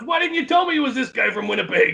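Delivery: high, relieved mock exclamation; imitated sudden friendliness. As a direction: retell his cheerful change of heart. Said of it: "then he starts laughing".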